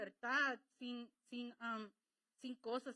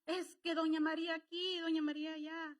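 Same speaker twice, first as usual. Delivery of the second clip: higher, louder mocking chatter; mock gossip; 5 semitones higher, 5 dB louder than usual. Preacher mocking people complaining to doña María, hammed up. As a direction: ham up the busybody.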